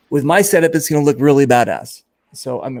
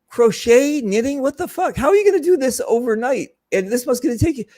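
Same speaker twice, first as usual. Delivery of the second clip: high-pitched retold disbelief, how is that even possible; incredulous; 10 semitones higher than usual. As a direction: pitch shoots way up in mock astonishment. Retold disbelief about overnight crochet.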